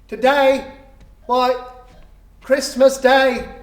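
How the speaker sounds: a lightly voiced surprised lad; street boy.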